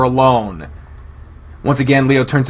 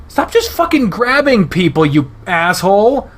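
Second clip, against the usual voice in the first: high, loud indignant yell; real outburst; 8 semitones higher, 4 dB louder than usual. Real, not acted. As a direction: shout at the character in real annoyance. Reader yells at Leo.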